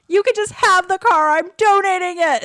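high, loud mock tantrum; throwing a hammed-up fit about the car; retold tantrum. To the parking office, a hammed-up tantrum. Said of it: "had a little temper tantrum".

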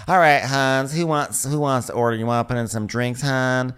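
lightly voiced waitress patter. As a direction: take the order like a diner waitress. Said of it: "the waitresses come over and is like".

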